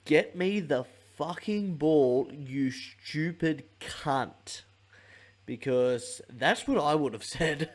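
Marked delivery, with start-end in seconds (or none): 0.0-4.6 slow, drawn-out mock blow-up; hammed-up demand for the ball, dragged out; imitating an angry footballer. Playmaker snarling at a teammate, mock furious and slow. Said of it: "that he said".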